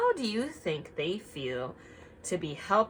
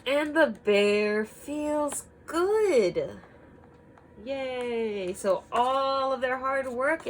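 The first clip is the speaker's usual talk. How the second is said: slow, loud, higher happy announcement; joyful reading; 6 semitones higher, 6 dB louder, slower than usual. A narrator's reading. Reading the happy ending to children, joyfully. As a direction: stretch it out with delight.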